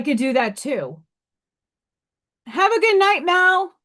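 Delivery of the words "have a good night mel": higher and louder, a friendly call across the room; calling out goodbye. Real, not acted. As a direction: call out a goodnight to a friend leaving. Calls goodbye to someone present.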